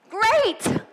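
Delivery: high, forced "great, good"; through gritted teeth. Retold or imitated. Wife's go-to answer when asked how she is doing, through gritted teeth. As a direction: push out a mock-cheery answer through clenched teeth. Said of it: "Sometimes it's through gritted teeth".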